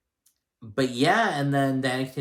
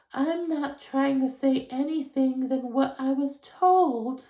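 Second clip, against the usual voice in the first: much higher, slow whiny mock of Lisa; victim voice; slower than usual. Mocking Lisa playing victim after the receipt, high and slow. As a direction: play the wounded victim like Lisa. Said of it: "switches to victim".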